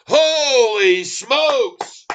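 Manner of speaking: high, loud, drawn-out slow cry; thrilled exclamation. Real, not acted. Streamer celebrating his stock's jump, drawn-out thrilled cry. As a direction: savor the win out loud. Watching GCT chart jump.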